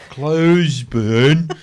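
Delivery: Closeburn dragged out very slowly; slowed-down word. Retold or imitated. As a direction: stretch the name like the echo has slowed you. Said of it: "And she starts to slow down".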